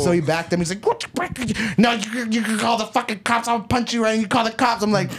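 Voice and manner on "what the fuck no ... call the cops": high, garbled imitation of an angry man; mimicked ranting, words tumbling and mangled; mock speech impediment. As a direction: sputter threats in a mocking impression. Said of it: "he had a speech impediment".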